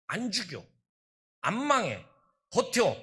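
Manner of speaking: loud, urgent command to endure; urgent exhortation. Real, not acted. Pastor urging the struggling congregation, loud and urgent. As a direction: drive it home like an order.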